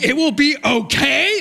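high, slow laughing question; husband laughing incredulously at wife; laughing disbelief. Retold or imitated. Incredulous at wife's calm reply.

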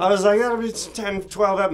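high, loud surprised guess; startled realization. Retold or imitated. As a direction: blurt it out in surprise. Realizing arc length.

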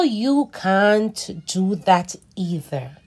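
put-on slow, expressive read-aloud for kids; reading to children. A narrator's reading. Teacher reading a story to children, animated and slow. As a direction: read it like a picture book for little ones. Reads picture book aloud.

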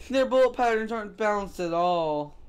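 ordinary delivery

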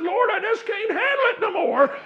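high, full-voiced revival shout; imitated shouting, very loud and high; ecstatic church shouting. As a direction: cry out to God like an overwhelmed worshipper. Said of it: "And she shouted".

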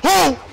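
high, loud "oh"; short yelp of alarm; shouted burst of shock; startled cry. Real, not acted. Startled by attack.

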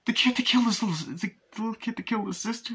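very high, rising mock disbelief; incredulous question. [name] asks about the kid who killed his sister, incredulous. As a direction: squeak it upward as a stunned question.

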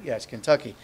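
normal talking voice

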